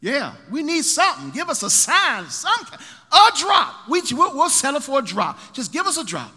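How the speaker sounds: high, loud frantic begging; desperate pleading. Acted. Baal's prophets desperately begging for a single drop. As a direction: beg for just a drop. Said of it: "they start to cut themselves".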